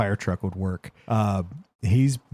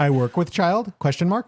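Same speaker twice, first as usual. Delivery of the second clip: put-on child with a high rising question tune, then question mark; mock the odd phrase as a question; 8 semitones higher than usual. Host teases a friend's odd phrasing as a question.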